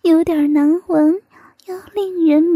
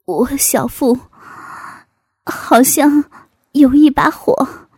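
halting, strained complaint building louder; strained discomfort; 2 semitones lower, 5 dB louder than usual. Acted. Man answers her question, in a strained, halting voice. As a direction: force it out in short, effortful bursts.

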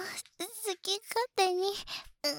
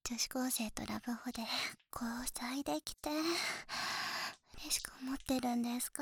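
soft teasing whisper; 3 semitones lower, 5 dB quieter than usual. Acted. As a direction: whisper it close to the ear.

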